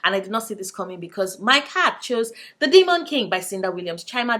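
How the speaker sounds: ordinary talk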